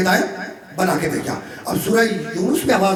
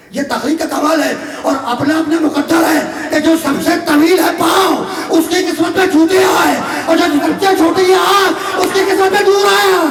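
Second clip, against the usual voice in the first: higher and louder, shouted sermon climax; preaching at full force; 8 semitones higher, 5 dB louder than usual. Acted. Preacher to his listeners on fate, loud shouted preaching. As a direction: thunder the lesson out to the crowd. Preacher drives home the point.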